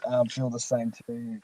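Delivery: usual speaking voice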